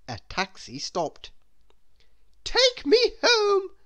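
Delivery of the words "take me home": very high, loud, slow command; haughty order. Acted. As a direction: order like a grand lady. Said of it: "she ordered".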